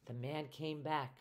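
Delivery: ordinary delivery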